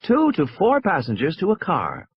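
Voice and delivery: high, measured public announcement; recorded announcement. A narrator's reading. Recorded voice instructing passengers at the cars, announcing. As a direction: read it out like a recorded boarding notice. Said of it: "a recorded voice was saying".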